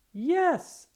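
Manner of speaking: high, loud cheer of joy; excited cheer. Acted. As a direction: shout it out, thrilled. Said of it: "I cheer".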